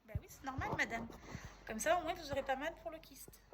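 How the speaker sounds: loud retold exchange. Dialogue with doctor, retold.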